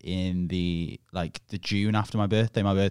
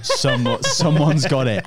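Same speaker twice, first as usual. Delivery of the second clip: laughing through the words, a bit louder; 5 semitones higher, 7 dB louder, slower than usual. Real, not acted. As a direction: chuckle it out, amused. Laughing reveal of footage.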